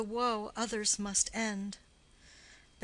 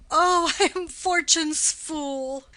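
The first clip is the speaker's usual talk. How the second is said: slow, higher and louder lament with a bitter laugh; despairing cry; 4 semitones higher, 6 dB louder than usual. Acted. Young man grieves his fate after killing his wife's cousin, bitter slow wail. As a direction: wail at your own cursed luck.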